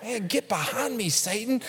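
softly muttered comic aside; under-the-breath retort, playfully imitated; mock muttered rebuke. Dad pestered by his kids, muttering a mock rebuke.

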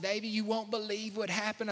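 a hint of a devastated old woman, quiet; mild mock distress of an old lady; lightly voiced upset grandmother. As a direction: softly retell her grandmother's dismay. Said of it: "she was just like".

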